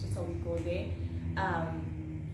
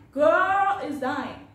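very slow, louder; drawn-out dread; 4 semitones higher, 6 dB louder, much slower than usual. Retold or imitated. Panicking at home, slow hammed-up dread. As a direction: stretch each word in mock doom.

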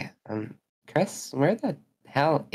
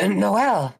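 high character voice; 9 semitones higher, 4 dB louder than usual. Acted. Voicing game character.

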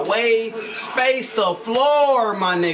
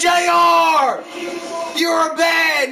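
louder, higher yell at the teammate; angry yell; 5 semitones higher, 3 dB louder, much slower than usual. Real, not acted. Gamer scolding teammate J R, angry yell. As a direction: call him out hard. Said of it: "I never raged like that before".

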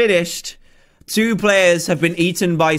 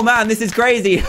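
ordinary talk

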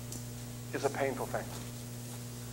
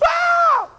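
high shouted mock wail, louder; mock crying wail; 8 dB louder than usual. Preacher crying back at the daycare kid, loud high mock wail. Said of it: "I cried exactly the way this".

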